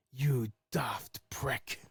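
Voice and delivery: put-on slow, soft, half-hearted British accent; mumbled British insult, accent barely there; attempted British accent. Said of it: "I can't even do the accent".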